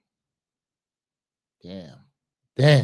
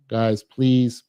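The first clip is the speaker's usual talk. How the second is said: usual speaking voice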